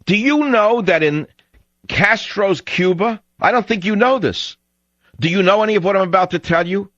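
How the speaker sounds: high-pitched, heated rhetorical questioning; indignant challenge. Real, not acted. Radio host challenging listeners about Castro's Cuba, indignant. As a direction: press the listener with worked-up, high-pitched insistence.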